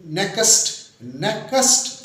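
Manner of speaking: नेक्स्ट said ne-kast, vowel inserted; wrong two-syllable next. Shown as a demonstration. Teacher imitating some people's error in "next", as two syllables. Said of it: "तो दो syllable है".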